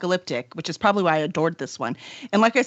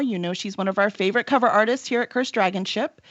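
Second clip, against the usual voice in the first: ordinary talk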